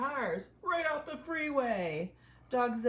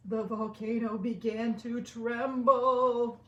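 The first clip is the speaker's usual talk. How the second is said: loud, slow, higher dramatic narration; dramatic build; 4 semitones higher, 8 dB louder, slower than usual. A narrator's reading. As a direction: boom it out as the volcano shakes. Dramatic climax of story.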